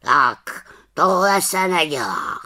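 slow, whispered scolding; reproachful. A narrator's reading. Raven Abraxas scolds the witch, in a reproachful whisper. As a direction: scold gently but firmly. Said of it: "řekl káravě".

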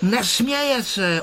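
high, slow objection; high-pitched contrary retort; contradicting. A narrator's reading. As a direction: argue back, drawing it out. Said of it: "odporoval Ferda".

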